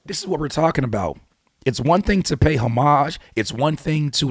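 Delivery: ordinary talk, nothing marked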